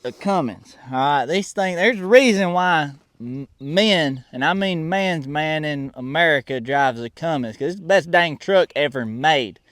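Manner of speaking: put-on proud good-old-boy with a Southern accent. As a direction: brag on the truck in a Southern accent.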